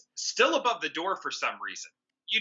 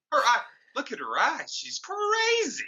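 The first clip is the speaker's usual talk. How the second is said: mock shocked character; 8 semitones higher than usual. Mocking the film characters.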